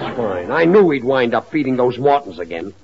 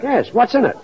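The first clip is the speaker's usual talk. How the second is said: exasperated question; 10 semitones higher than usual. Acted. George losing patience with Gracie.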